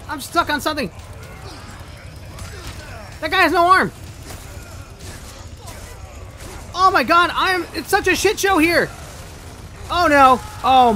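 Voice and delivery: loud high panicked shouting; frantic, trapped and yelling; being chased. Real, not acted. Stuck while enemies attack.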